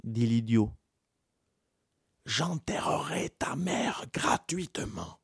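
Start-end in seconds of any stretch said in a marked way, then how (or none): 2.2-5.2 soft unvoiced whisper; secretive whisper; whispered offer. A narrator's reading. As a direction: whisper the deal. Priest offers free burial.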